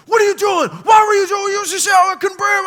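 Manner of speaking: high, loud, accusing yell; angry shout. Retold or imitated. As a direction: go at him like you're about to fight. Kids almost fighting after pile.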